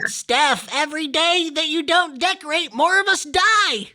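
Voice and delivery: high-pitched little elf voice; elf impression. Elf begs co-host to decorate, high elf voice. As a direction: plead as a tiny, squeaky Christmas elf. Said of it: "you do a really good elf impression".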